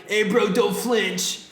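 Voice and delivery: slow, high Southern California bro drawl; Southern Cal accent. Acted. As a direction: stretch it out like a laid-back SoCal dude. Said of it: "I gave him, like, a Sou-, Southern Cal accent".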